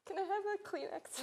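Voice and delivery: a high, soft, teary aside with a laugh; tearful. Real, not acted. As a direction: ask for a tissue, choked up and half laughing. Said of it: "getting a little emotional".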